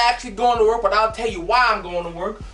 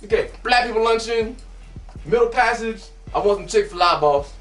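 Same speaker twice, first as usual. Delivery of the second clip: normal talking voice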